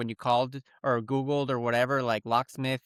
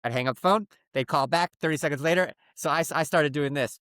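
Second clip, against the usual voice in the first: ordinary talk, nothing marked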